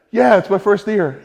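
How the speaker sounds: high, loud and proud; proud cheer. Retold or imitated. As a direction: shout it with glowing pride. Proudly confirming first deer.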